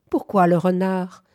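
ordinary delivery

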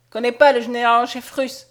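loud, irritated refusal; irritated soldier, loud and brusque; increasingly irritated. A narrator's reading. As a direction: dismiss it with rising impatience. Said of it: "de plus en plus irrité".

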